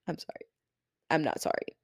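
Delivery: ordinary delivery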